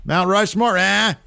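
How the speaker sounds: high, stretched promo cry; Big E. Retold or imitated. Big E riling the crowd about Mount Rushmore, high drawn-out cry. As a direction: hold the last sound high and long. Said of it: "And Big E says".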